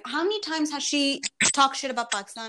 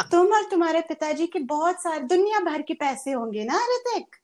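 high, fast, hammed-up scolding; mock angry rant; 5 semitones higher, 3 dB louder, much faster than usual. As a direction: rattle it off like a jilted diva. Mimicking Kangana berating Hrithik.

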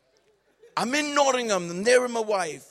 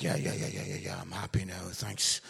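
sheepish mumble; 6 dB quieter than usual. Retold or imitated. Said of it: "I was like".

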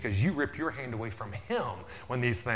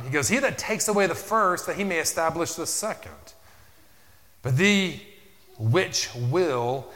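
preaching scripture; 6 dB louder than usual. Acted. Preacher reading scripture aloud to the congregation, loud. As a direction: proclaim the verse to the church.

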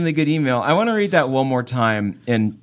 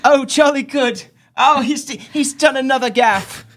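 put-on high, plummy British kid voice; British child audience; 11 semitones higher than usual. British children watching a comedy special, high-pitched kid commentary. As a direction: chirp like an approving British child. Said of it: "they would all just be like".

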